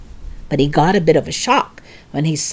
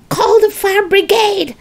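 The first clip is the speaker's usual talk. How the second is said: high, loud alarmed shout; sudden shout; 13 semitones higher, 5 dB louder than usual. A narrator's reading. Grandma with burning stomach, suddenly shouting. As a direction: burst out at the top of the voice. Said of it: "she shouted suddenly".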